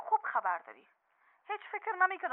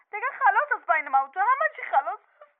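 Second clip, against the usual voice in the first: louder, higher, breaking off; final outburst; 4 semitones higher, 5 dB louder than usual. Acted. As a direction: declare it over in fury. Wife ends marriage on phone. (فریاد پایانی)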